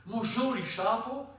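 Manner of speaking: «Mon joli chapeau» with «joli» lifted high and slow; mock wrong stress. Shown as a demonstration. Lecturer mocking the stress on «joli» to make the class laugh. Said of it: "fait rire un francophone".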